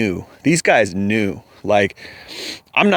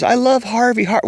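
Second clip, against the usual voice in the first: mock adoring actor; 10 semitones higher than usual. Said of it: "all these young Hollywood actors".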